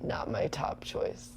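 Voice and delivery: soft, slow, restrained tone; polite understatement. Retold or imitated. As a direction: say it politely, holding back distaste. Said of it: "but I was like".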